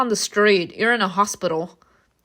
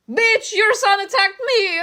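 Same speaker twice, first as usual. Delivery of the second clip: high, piercing furious mother; shrill accusing voice; 12 semitones higher, 5 dB louder than usual. Acted. Mother yelling at the psychologist, high and shrill. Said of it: "interrupted by a shrill voice".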